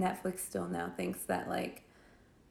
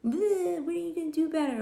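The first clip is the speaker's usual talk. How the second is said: higher, louder taunting bully voice; mock movie bully; 9 semitones higher, 5 dB louder, faster than usual. Bully taunting the hero, hammed-up much higher sneer. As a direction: ham up a cartoon school bully. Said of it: "whatever high school bullies in movies say".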